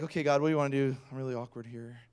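soft, sinking aside; awkward private prayer. Retold or imitated. Pastor asking God for help mid-conversation, quiet and awkward. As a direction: drop to a near mutter, unsure.

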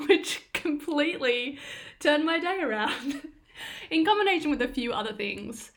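laughing through the words. Real, not acted. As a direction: giggle as you say it. Laughing about accountant pep talk.

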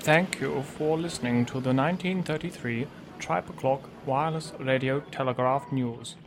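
deep, formal radio sign-off; vintage newsreel closing; old radio announcer. Acted.

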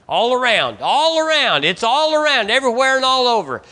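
high, loud, ringing cry; preaching shout. Acted. Preacher shouts about danger on every side, loud. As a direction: cry it out over the congregation.

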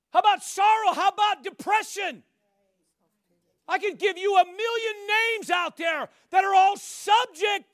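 loud, high, driving preacher cadence; fiery pulpit preaching; full-force preaching. Acted. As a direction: hammer each question at the congregation. Preaching list of names.

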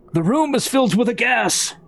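high, called-out warning; theatrical alarm cry; in-character warning. Acted. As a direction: call it out to the party, pitched high. Player calls out warning in character.

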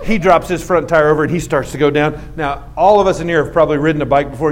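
ordinary talk, nothing marked

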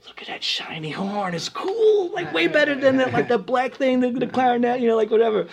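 high, lightly voiced kid admiring a shiny horn; starry-eyed kid. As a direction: gush over the horn like a little kid. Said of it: "And I'm like".

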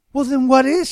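high, bright-eyed innocent ask; a hammed-up innocent maiden asking; innocent question. A narrator's reading. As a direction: play it wide-eyed and guileless. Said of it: "she asked innocently".

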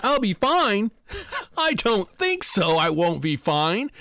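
high, laughing disbelief at her answer; incredulous echo. Retold or imitated. Dreamer mocking the actress's calm answer, high incredulous laugh. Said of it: "I'm completely freaked out".

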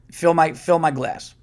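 normal talking voice

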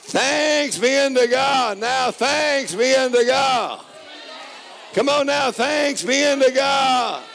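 high, loud, slow preacher's shout; preaching at full force. Acted. Preacher lifting the congregation in praise, loud and slow. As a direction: lift it up and ring it out.

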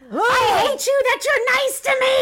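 high hammed-up screech; over-the-top hysterical wail; hysterical Heaven. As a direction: shriek it way up high, mock-hysterical. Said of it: "Heaven would be, just be like".

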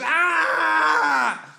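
long, high, very loud imitated scream. Comic imitating wife in labour, loud high scream. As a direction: scream it out as one sustained wail. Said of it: "she was really screaming".

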